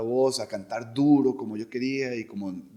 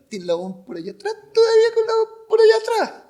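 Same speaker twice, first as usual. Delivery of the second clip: high, loud mock-Chilean caricature; exaggerated Chilean squawk, voice swallowed backward; Chilean family, voice far back; 9 dB louder than usual. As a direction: push a Chilean accent up high and back in the throat. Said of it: "tenemos la voz muy atrás, y más mi familia chilena".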